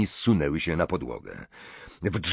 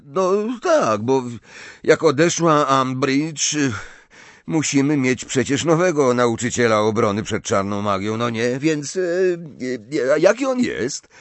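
put-on higher, halting boy's voice with fillers; Ron, awkward; 6 semitones higher, 5 dB louder than usual. A narrator's reading. Ron asks about the new teacher, awkward and halting. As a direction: fumble through an excuse in a raised character voice.

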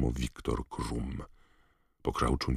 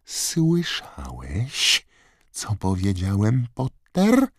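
slow, high-pitched drawl rising to a sharp finish; taunting Snape; 8 semitones higher, 5 dB louder, much slower than usual. A narrator's reading. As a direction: stretch the words, then jump up loudly on the surname. Snape taunts Harry while refusing. (przeciągłe, złośliwe pytanie)

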